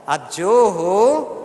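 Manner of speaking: बहुत प्यार से. Shown as a demonstration. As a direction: lead the room, stretching each word with love. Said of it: "बहुत प्यार से आप बोलिए". (प्यार से, लंबा खींचकर)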